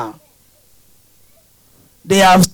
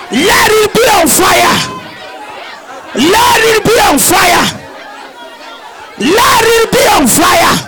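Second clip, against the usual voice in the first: shouted fire prayer; 9 semitones higher, slower than usual. Real, not acted. Pastor leading the congregation in a fire prayer, shouting.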